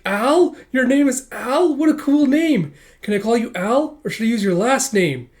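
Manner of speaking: put-on high, loud girlish gushing; gushing delight. Girlfriend thrilled to learn his name, gushing. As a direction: gush over a new acquaintance.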